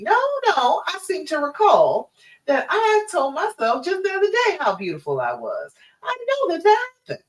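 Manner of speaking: high-pitched, defiant comeback to the brain; mock retort. Talking back to her own doubting brain, high and smug. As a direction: squeak a smug no, no right back at it.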